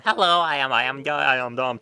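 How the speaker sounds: hammed-up dumb guy; mock dumb voice. Shown as a demonstration. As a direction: play it dumb and squeaky. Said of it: "My voice goes from".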